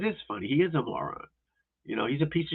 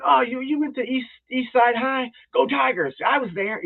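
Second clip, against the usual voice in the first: much higher, chummy mock of the killer sucking up; mocking buddy-buddy voice; 11 semitones higher than usual. Killer chatting up the cops, high mocking buddy voice. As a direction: play the fake-friendly punk schmoozing. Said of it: "he's laughing with them and going".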